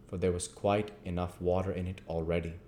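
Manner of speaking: ordinary delivery, nothing marked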